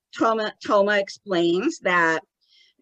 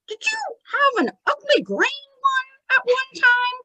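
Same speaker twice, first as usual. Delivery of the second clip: very high puppet questioning; childlike put-on falsetto; Tommy. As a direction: quiz innocently in a squeaky spirit voice. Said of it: "Tommy is asking him".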